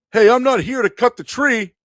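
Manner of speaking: high loud dopey voice; dim coworker. Retold or imitated. Dim coworker answers the tree joke, loud and high-pitched. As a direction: play him proudly dumb. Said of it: "the smart guy said".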